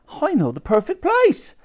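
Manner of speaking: high-pitched character voice; Dottie. A narrator's reading. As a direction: pipe up with a bright idea. Dottie has an idea.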